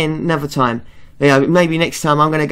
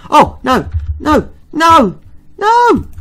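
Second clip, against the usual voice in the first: real panicked cries climbing higher and louder; rising panic; 11 semitones higher, 5 dB louder, slower than usual. Real, not acted. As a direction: yelp each no in mounting alarm. Panicking at a skeleton in game.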